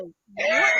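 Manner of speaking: loud laughing what; mock shout. Retelling how he yelled at her, loud laughing shout. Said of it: "And he was like".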